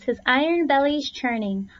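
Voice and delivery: ordinary delivery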